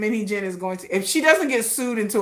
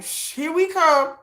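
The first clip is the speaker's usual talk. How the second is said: sneaky hush; 8 semitones higher, slower than usual. Pretend. Fans lying in wait, hushed.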